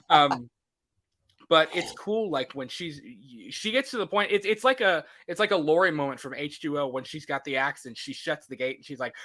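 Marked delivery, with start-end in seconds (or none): none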